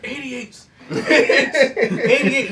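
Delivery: high, loud mock movie scream; imitated scream. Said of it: "they just screamed".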